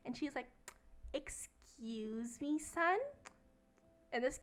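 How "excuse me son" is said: mock Karen voice. Karen voiced in retold story.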